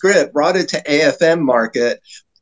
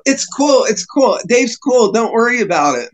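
high-pitched mock placating; anxious peacemaker imitation; 8 semitones higher than usual. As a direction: pipe up as if defusing a fight. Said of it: "He's trying to get in between us".

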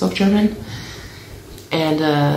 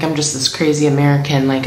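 normal talking voice

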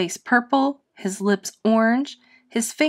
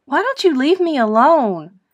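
upset protest; 6 semitones higher, 5 dB louder than usual. Acted. Bullied boy protests.

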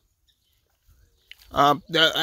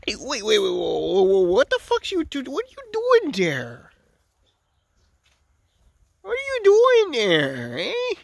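rising, higher and higher wait whoa; startled call; 6 semitones higher than usual. Real, not acted. Drunk man calling to cows eating a tree, rising surprise.

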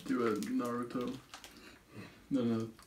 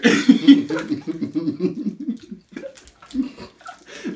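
big squealing laugh; loud high laugh; 11 semitones higher, 13 dB louder than usual. Real, not acted. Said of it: "You sound like Nigel Thornberry".

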